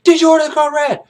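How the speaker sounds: very high, loud demand; shouted question. Retold or imitated. Kaffee shouts the key question at Jessup. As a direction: fire it at him. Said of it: "He's like".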